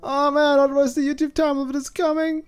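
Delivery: high, loud frantic squeal of panic; panicking over the YouTube time limit; mock panic. Comic frantic high squeal. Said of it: "I have to scramble every time".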